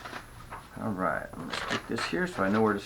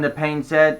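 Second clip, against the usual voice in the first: ordinary delivery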